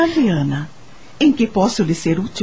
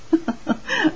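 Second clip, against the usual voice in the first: short soft amused laugh; a brief chuckle of fond amusement; light laugh; 9 semitones higher, 4 dB quieter than usual. Acted. Lady of the mansion amused by the girl, soft laugh.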